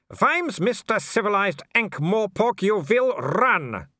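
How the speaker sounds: menacing command. Acted. Werewolf orders Mister Civilized to run, menacing. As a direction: order him off as an irate werewolf.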